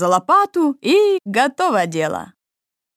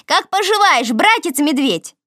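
calling out; 6 semitones higher than usual. Acted. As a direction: hail the bear cheerfully. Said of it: "Кролик окликнул его". (окликая сверху)

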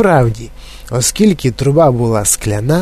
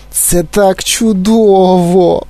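slow, high-pitched moan of delight; blissful moan; 6 semitones higher, much slower than usual. A narrator's reading. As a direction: groan it out slowly in bliss. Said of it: "простагнав Августус". (блаженний протяжний стогін)

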